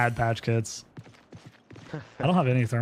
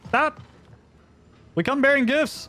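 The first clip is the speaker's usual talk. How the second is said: calling down a hallway; 14 semitones higher, slower than usual. Real, not acted. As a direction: call out to stop someone. Calling out to a stranger in-game.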